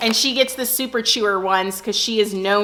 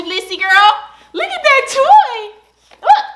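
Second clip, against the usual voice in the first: very high, loud cooing; 12 semitones higher, 7 dB louder than usual. Real, not acted. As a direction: coax the dog with a squeaky voice. Showing toy to dog Blissy.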